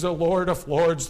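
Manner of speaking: ordinary delivery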